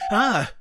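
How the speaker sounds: sudden loud startled cry; startled yelp. Acted. Crew member fiddling with the console, startled yelp. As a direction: jump at a surprise burst from the console.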